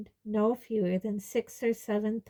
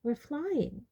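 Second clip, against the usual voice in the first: higher-pitched, matter-of-fact certainty; maddeningly sure of it; maddeningly unbothered; 5 semitones higher, 4 dB quieter than usual. A narrator's reading. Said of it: "said Luna maddeningly".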